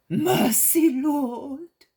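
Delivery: high, loud desperate plea; pleading cry. A narrator's reading. Leper at Jesus' feet begging, high and loud. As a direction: beg him for mercy.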